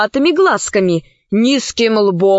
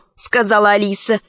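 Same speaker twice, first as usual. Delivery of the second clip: plain reading voice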